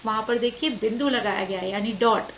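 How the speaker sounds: usual speaking voice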